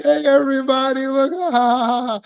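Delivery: high, mocking schoolyard jeer; jeering bully call. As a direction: call out to everyone, laughing at her. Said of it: "be like".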